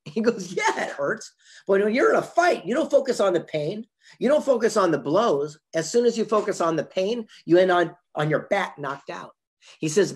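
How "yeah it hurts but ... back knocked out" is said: higher, louder retelling of a boxer's pep talk; emphatic retold answer. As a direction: drive the point home like a champion, loud and high.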